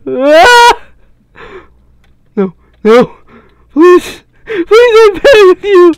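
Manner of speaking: high, loud, hammed-up begging; desperate pleading shout. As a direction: beg at the top of your voice. Begging game character to stop.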